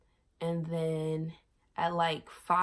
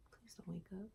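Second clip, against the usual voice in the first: put-on whispered plea to the baby; hushed plea; 18 dB quieter than usual. Mom-to-be whispering to her unborn baby, pleading. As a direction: breathe it out under your breath, begging.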